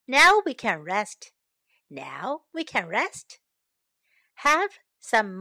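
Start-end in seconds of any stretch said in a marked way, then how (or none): 1.9-3.4 lower, softer, settled; relieved, soft. Shown as a demonstration. Teacher modelling a tired, relieved sigh of a line. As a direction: let it sink, soft and relieved. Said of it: "teacher models the line again".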